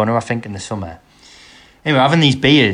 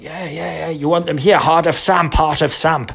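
lightly voiced eager club-shop seller, pitched up; a hint of a higher put-on vendor voice; imitated ticket seller; 4 semitones higher than usual. Club shop seller offers match tickets, lightly imitated higher voice.

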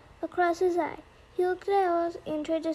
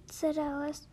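plain reading voice

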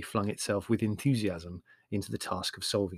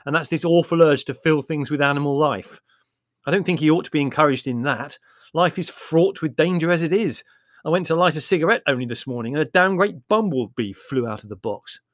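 loud, higher aggrieved rant; bitter; 5 semitones higher, 8 dB louder than usual. Acted. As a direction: grumble bitterly about it. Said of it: "said Larry bitterly".

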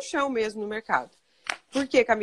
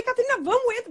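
higher pitched mock impatience; irritated mom; 10 semitones higher than usual. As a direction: hurry the kid into the car, annoyed. Mãe irritada chama a filha. (mãe impaciente, voz mais aguda)

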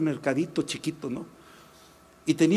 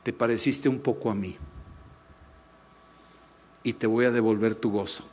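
voice of God; 6 semitones lower, 4 dB quieter than usual. Retold or imitated. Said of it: "Dios me estaba diciendo".

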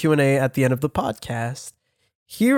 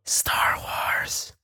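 whisper; 9 dB quieter than usual. Real, not acted. Whispered answer.

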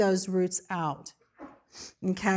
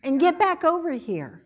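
high, loud insistence; firm rebuke; 6 semitones higher, 3 dB louder than usual. Acted. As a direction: put the flesh in its place. Preacher rebuking the flesh.

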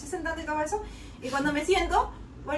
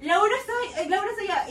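alarmed call; 7 semitones higher, 4 dB louder, faster than usual. Retold or imitated. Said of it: "y yo digo".

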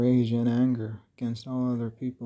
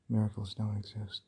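ordinary delivery

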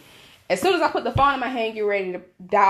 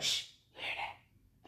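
slow, very soft whisper; shush, then whisper it slowly; mock secretive whisper; imitated whisper; 16 dB quieter, slower than usual.

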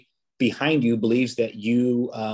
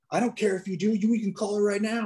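higher-pitched, mildly worked-up comeback; imitated heated retort; 9 semitones higher than usual. Driver daring the officer to call his supervisor, imitated heated retort. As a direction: play an upset man brushing off a threat. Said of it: "the guy's heated".

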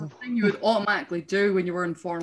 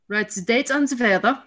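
put-on German-accented teacher, raised and bright; Austrian accent; 5 semitones higher, 4 dB louder than usual. German teacher calling the class to order in Austrian accent. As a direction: bark it like a strict Austrian teacher. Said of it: "I think I can do an attempt at this accent".

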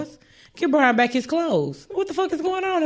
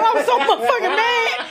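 laughing, higher-pitched; 9 semitones higher than usual. Real, not acted. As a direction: pushes it out through laughter.

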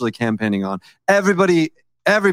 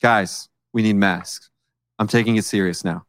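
imagined Trump; 7 semitones lower than usual. Retold or imitated. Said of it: "this is the perfect opportunity for him to say".

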